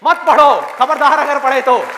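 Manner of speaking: loud, high forbidding command; acted shout of a threatening prohibition; stern warning. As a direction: warn them off forcefully, drawn out. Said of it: "पूरी दुनिया आकर के आपको कहे कि मत पढ़ो".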